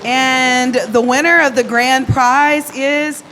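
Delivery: slow, building announcer reveal; booming prize announcer. Real, not acted. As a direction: draw out the big reveal. Announcing the grand prize winner.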